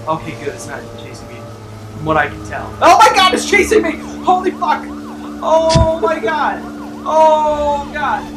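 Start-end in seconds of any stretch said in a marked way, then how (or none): 2.8-8.2 panicked shouting, high and loud; being chased. Real, not acted. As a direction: scream in panic while fleeing. Dog chasing him.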